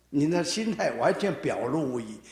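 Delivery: ordinary talk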